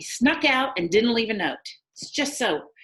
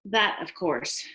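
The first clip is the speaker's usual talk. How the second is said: normal talking voice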